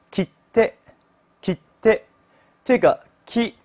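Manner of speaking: "切って" with the i almost silent; devoiced vowel. Shown as a demonstration. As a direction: clip the vowel in "切って" to a breath. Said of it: "你要注意，你看它们好像只发辅音".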